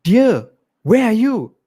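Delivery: a wife calling for her vanished husband, worried. Acted. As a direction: call out to find him.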